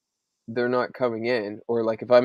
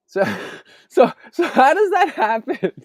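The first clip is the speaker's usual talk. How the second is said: laughing; 13 semitones higher, 6 dB louder than usual. Real, not acted. Laughing while quoting son.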